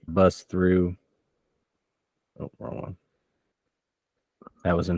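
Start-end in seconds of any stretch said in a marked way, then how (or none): 2.4-3.0 unvoiced muttered aside; whispered aside. Real, not acted. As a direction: whisper it to yourself, off mic. GM aside while checking notes.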